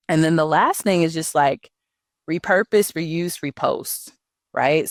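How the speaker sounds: ordinary delivery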